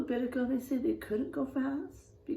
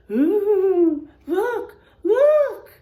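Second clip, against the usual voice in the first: excited; 8 semitones higher, 12 dB louder, much slower than usual. Acted. Acting the scary moment.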